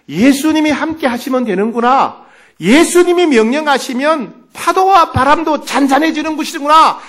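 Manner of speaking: high, building to loud proclamation; surging conviction. Acted. Fearful sister reads the passage, with surging conviction. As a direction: let the realization grow into triumph. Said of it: "그런 확신이 들더라는".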